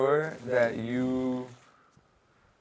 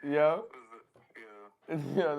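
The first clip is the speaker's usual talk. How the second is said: ordinary delivery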